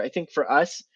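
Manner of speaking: ordinary delivery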